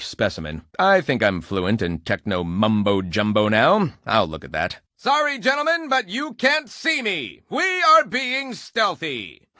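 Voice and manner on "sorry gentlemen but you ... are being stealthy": mock stealth. Sneaking past guards.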